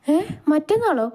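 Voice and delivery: high-pitched startled question; surprised. A narrator's reading. Wife learning he joins day after tomorrow, surprised. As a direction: echo it in surprise, voice leaping up.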